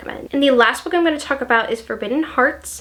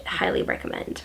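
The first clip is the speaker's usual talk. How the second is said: whispered recommendation; 9 dB quieter than usual. Real, not acted. Closing a book recommendation.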